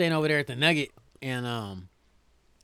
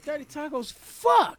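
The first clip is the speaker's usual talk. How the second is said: exasperated outburst; 8 semitones higher, slower than usual. Retold or imitated. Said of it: "He's like".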